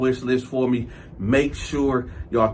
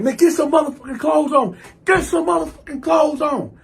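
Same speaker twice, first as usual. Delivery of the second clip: high, insistent retold shout; worked-up, high-pitched command; angry order; 12 semitones higher than usual. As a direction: order him about in exasperation.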